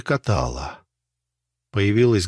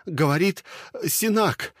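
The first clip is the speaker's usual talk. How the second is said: desperate high distress call; frantic distress call; 11 semitones higher than usual. A narrator's reading. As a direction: blurt everything out in panic.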